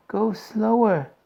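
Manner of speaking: slow, high and out of breath; panting. A narrator's reading. Missus Salt, winded on the stairs, begs Wonka, panting. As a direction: gasp it out after the stairs. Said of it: "panted Missus Salt".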